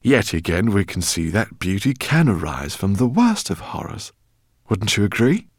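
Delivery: put-on high, loud, fussy old scholar; eccentric professor. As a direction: voice him as an excitable professor. Professor speaks in story.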